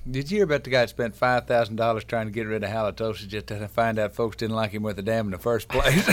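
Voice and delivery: ordinary talk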